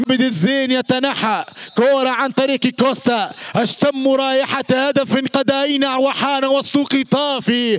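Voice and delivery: normal talking voice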